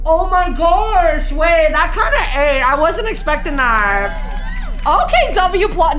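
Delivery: real thrilled reaction; impressed excitement. Real, not acted. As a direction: rave about the twist, stretching the words. Thrilled praise of the plot twist.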